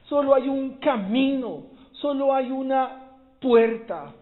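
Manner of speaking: loud, high, hammering each "solo"; preaching at full force. Acted. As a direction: drive home every "solo hay". Predica con toda fuerza. (predicación enfática, alta y fuerte)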